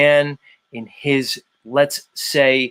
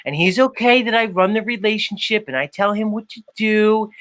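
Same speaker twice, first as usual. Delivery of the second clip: higher-pitched Chicago accent impression; 4 semitones higher than usual. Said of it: "[name] trying to do a Chicago accent".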